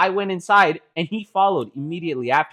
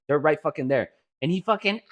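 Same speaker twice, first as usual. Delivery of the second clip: usual speaking voice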